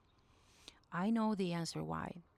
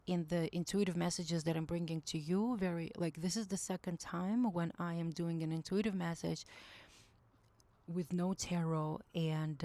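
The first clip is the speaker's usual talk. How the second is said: usual speaking voice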